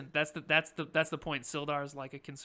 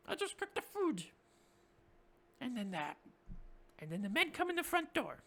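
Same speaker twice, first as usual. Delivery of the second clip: high, squeaky little goblin cook; high goblin voice, pleading he's harmless; 9 semitones higher than usual. Acted. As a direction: pitch way up like a timid goblin.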